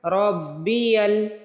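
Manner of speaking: रब्बियल with a doubled bb; रब्बियल, ra joined to ba. Shown as a demonstration. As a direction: hold the bb in रब्बियल. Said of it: "रा को बा से मिलाना है।".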